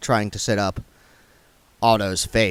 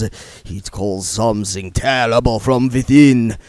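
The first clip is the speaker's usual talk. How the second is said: slow, louder strained vampire voice; vampire confessing his thirst, slow and strained; tormented vampire; 5 dB louder than usual. Acted. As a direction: struggle against the hunger. Otto explains his vampire urges.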